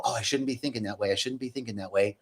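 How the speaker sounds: quick, quiet inner voice; low, hurried self-talk; muttered self-reproach. Acted. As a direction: scold yourself under your breath, fast. Acted self-scolding inner thought.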